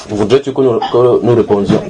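ordinary talk

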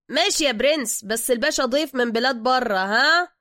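lightly voiced loud driver; obliging driver. Driver hints about his foreign guest to the thug, loud and obliging. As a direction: say it up and loud, with a nudge.

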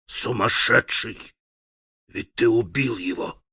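ordinary delivery

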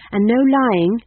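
put-on earnest, insistent young-witch warning. A narrator's reading. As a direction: lift the pitch and press the point earnestly. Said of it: "said McGrath earnestly".

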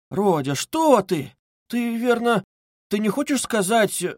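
high-pitched, faltering alarm; frightened. A narrator's reading. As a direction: start in fright, then break off. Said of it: "начала было в испуге".

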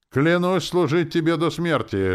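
high, loud cry; shouted oath. A narrator's reading. Captain shouts his oath after departing lord, loudly. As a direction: shout the pledge at someone's back. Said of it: "выкрикнул Дюрекс".

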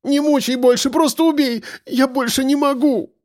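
high, anguished outcry; desperate begging. A narrator's reading. Captive begs for an end to torment, desperate. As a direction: plead desperately, pitched far up.

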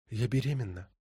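soft breathy confession; whispered. A narrator's reading. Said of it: "прошептала она".

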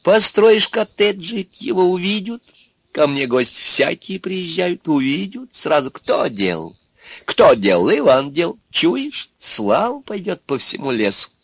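high, excited crone making a pitch; persuading Baba Yaga. Acted. Baba Yaga promises Ivan fame in the forest, coaxing. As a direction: tempt him with visions of fame.

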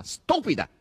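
high, loud offended jab; grumbled insult. A narrator's reading. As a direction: throw the insult after someone. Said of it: "brontolò dietro le spalle di Margherita".

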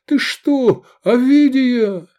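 high, loud, grief-stricken cry; desperate tearful howl; despairing wail. A narrator's reading. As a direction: call her name in anguish, drawn out. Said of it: "отчаянно завыл он".

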